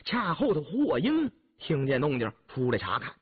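usual speaking voice

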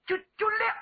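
high-pitched stuttering assent; stammer. Acted. Young warrior sent into the arena, stammering high. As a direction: catch on the first word, high. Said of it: "人送外号小磕".

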